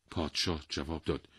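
plain reading voice

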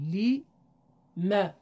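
لی ... م split into slow syllables; syllable-by-syllable demo; word by syllables. Shown as a demonstration. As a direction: break لی م apart for the class. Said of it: "هم کسره را داریم هم فتحه".

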